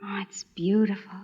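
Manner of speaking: breathy hush of wonder; awed whisper. Acted. Miranda to Nicholas over his flower, whispering. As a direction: whisper it, overcome by beauty.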